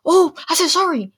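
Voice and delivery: high mock-whiny apology; mock voice of a person who expects to be forgiven; mocking the apologizer.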